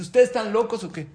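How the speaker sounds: high, loud exasperated outburst; exasperated rabbi. Acted. The rabbi scolds the quarrelling couple, loud and exasperated. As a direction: scold them, fed up.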